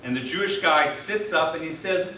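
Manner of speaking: usual speaking voice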